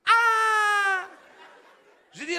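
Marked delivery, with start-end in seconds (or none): 0.1-1.1 high, loud startled yelp; shocked cry. Retold or imitated. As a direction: yelp in alarm.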